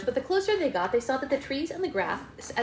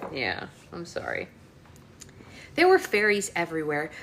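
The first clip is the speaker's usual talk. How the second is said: plain reading voice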